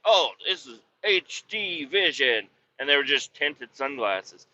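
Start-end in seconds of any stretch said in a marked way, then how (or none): 0.0-2.5 mock ad voice. Mocking a TV ad.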